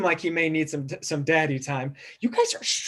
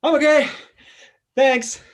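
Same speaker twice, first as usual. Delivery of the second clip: high, loud reassurance; loud, chipper all-clear; calling out; 11 semitones higher, 7 dB louder than usual. Acted. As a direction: holler it cheerily after a knock. Elf hit by cab calls out.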